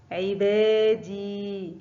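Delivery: عبادي very slowly, دي held long; teacher stretching the long ي in عبادي; drawn-out example word. Shown as a demonstration. Teacher showing pupils the long vowel, slow and stretched. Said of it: "ينطق دي".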